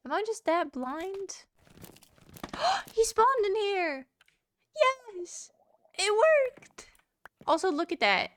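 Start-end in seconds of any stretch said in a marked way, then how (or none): 2.3-2.9 a sudden high gasp of surprise; sharp excited gasp. Real, not acted. His NPC finally spawned. 2.9-6.9 much higher and louder, overjoyed; thrilled. Real, not acted. His NPC finally spawned.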